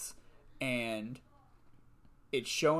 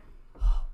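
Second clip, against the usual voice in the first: shocked gasp; 9 dB louder than usual. Real, not acted. Shocked at daily flossing.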